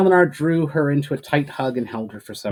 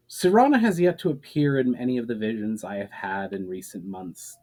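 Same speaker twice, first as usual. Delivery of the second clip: plain reading voice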